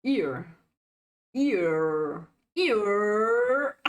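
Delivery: "Ear" repeated, growing longer with a drawn-out r; "Ear" held on its final r; exaggerated pronunciation practice. Shown as a demonstration. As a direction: sound out "Ear", stretching it further each time. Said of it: "you make fun with my pronunciation".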